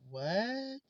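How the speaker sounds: startled, disbelieving what; shocked child. Retold or imitated. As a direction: imitate a kid's stunned question. Child's shocked reaction to grandmother.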